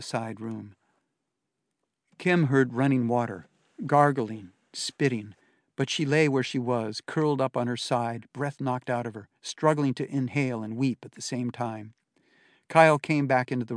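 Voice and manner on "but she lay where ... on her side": usual speaking voice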